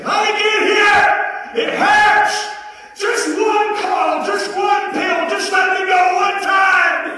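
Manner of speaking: high, loud desperate yells; frantic begging. Retold or imitated. Man in withdrawal screaming at his wife, frantic begging. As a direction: scream for relief.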